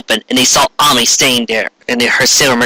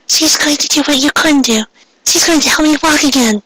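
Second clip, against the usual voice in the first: put-on much higher girl's voice, cold triumph; defiant Ami; 14 semitones higher than usual. Ami telling Ray who will heal her, high defiant voice. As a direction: throw it back at her, high and proud.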